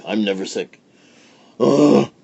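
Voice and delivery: plain reading voice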